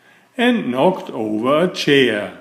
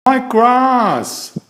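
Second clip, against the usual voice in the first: high, louder cry of dismay; anguished cry; 10 semitones higher, 4 dB louder than usual. A narrator's reading. Fussy man sees the cousin fall on his lawn, high anguished cry. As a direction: wail over the ruined lawn. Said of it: "cried Mister Fussy".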